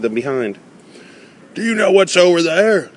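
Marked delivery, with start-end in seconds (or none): 1.5-2.9 high, urgent question called out; high-pitched urgent cry. Acted. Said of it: "I cried".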